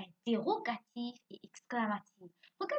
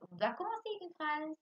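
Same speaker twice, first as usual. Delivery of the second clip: ordinary talk, nothing marked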